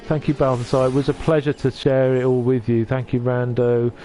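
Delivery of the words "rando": usual speaking voice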